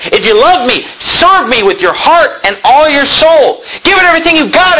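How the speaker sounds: preacher pushing the crowd to give everything; building preach. Acted. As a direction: climb from earnest plea to full-throated exhortation. Preacher pleading with congregation.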